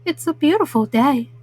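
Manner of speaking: ordinary delivery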